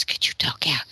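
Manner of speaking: retold whisper in the ear. Son confessing to mom about his sister, whispered. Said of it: "he whispers in my ear".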